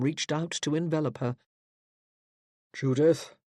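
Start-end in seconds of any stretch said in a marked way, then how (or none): 2.7-3.3 lower, grave and stern; pulpit voice. Acted. Stern father summoning his daughter, pulpit voice. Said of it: "It was his pulpit voice".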